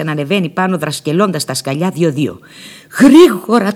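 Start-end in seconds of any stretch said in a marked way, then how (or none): 3.0-3.7 urgent call. Retold or imitated. Urgent command after informer leaves.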